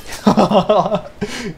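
high burst of disbelieving laughter; incredulous laugh. Real, not acted. Laughs after being destroyed.

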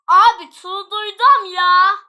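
loud, high, slow drawn-out complaint; exasperated shout. Real, not acted. Player says the game drives them crazy, exasperated shout. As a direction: stretch each word in a loud wail of exasperation.

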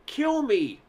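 high, loud, desperate shriek. A narrator's reading. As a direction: scream it as a plea for death. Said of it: "he shrieked".

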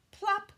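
high, loud plop; plop sound. A narrator's reading. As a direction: pop the word out like a drop. Grasshopper drops in.

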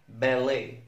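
ordinary talk, nothing marked

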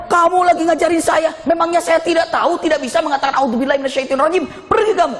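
high-pitched acted outburst of anger; mock-furious telling-off, rising to a dismissal; indignant scolding. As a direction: imitate an angry man rejecting advice. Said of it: "Malah dimarahin".

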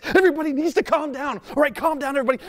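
high-pitched, rushed plea for calm; agitated, without peace. Acted. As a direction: order calm while clearly frantic yourself. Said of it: "it comes out more like this".

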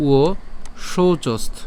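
very slow "উয়শৌচস্থ" in parts; demonstrated pronunciation. Shown as a demonstration. Teacher spelling it out for learners, very slow "উয়শৌচস্থ". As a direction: separate "উয়" from "শৌচস্থ", stretching it out. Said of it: "উচ্চারণটা কীভাবে করে করব?".

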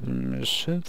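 usual speaking voice

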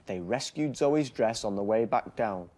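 put-on lively storybook reading for small children; bedtime story narration. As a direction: read it like a bedtime picture book.

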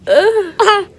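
usual speaking voice

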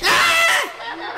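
very high, loud shriek of fright; piercing acted scream; startled scream. Wife finds a kid in closet, screaming.

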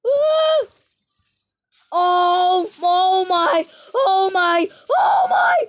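high startled yells; shocked shouting. Real, not acted. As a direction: cry out in alarm. Huge explosion in game.

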